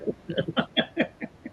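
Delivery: high airless wheeze of a laugh; breathless laugh. Real, not acted. Teller cracking up at his own punchline, wheezing. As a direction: laugh too hard to voice it.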